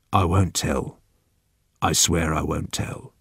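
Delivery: low, hushed promise; reassuring. Acted. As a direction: swear secrecy very softly. Old man promises secrecy.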